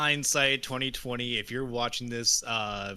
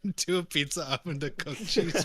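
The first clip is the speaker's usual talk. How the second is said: laughing through the words; giggling while telling the joke; 6 semitones higher, slower than usual. Real, not acted.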